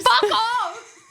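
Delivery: high, loud disbelieving laugh-yell; shocked laughing outburst. Real, not acted. Shocked at price of rock.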